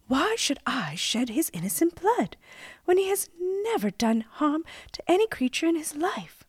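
high-pitched maiden's plea; protesting maiden. A narrator's reading.

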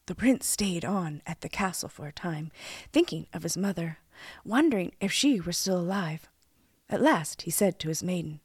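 plain reading voice

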